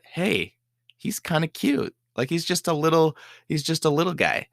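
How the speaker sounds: seeing the caged raccoon in daylight, doting coo. Retold or imitated. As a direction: coo as if at a puppy. Sees the trapped raccoon, finds it cute.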